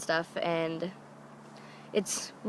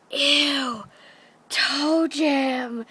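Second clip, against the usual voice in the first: drawn-out, higher mock disgust; imitating a grossed-out cousin; 5 semitones higher, much slower than usual. Cousin finds dad's foot in his face, grossed out.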